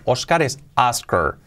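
"Oscar" said first Spanish, then as Asker; "Oscar" with the O almost an A; English Oscar. Shown as a demonstration. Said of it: "fijaos cómo pronunciamos".

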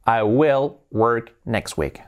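slow, word by word; slow model sentence. Shown as a demonstration. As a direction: space the words out for learners. Teacher models sentence slowly, then faster. (frase modelo, muy despacio)

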